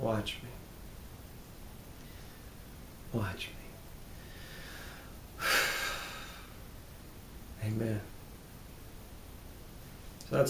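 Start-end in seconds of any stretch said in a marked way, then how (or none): 0.0-8.0 hushed private vow; soft self-talk. Shown as a demonstration. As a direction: close the affirmation almost under the breath. Closes the self-talk demonstration.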